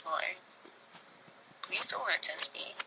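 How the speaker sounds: very soft, near-whispered prayer; retold hushed plea. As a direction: plead under your breath, as if recalled. Retells tearful prayer to God.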